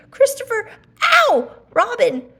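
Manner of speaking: high, loud yelp mid-call; stung cry. Acted. Pooh stung by a bee, loud high yelp. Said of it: "called out the cloud".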